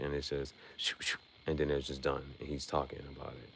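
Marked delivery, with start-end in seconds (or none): none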